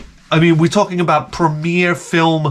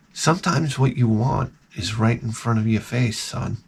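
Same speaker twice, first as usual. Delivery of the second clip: mock-profound fatherly hush; 4 semitones lower, 7 dB quieter than usual. Solemn dad over building movie music.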